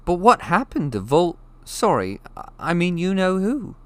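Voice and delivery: put-on high, eager curious boy; breathless question from a boy; young Harry.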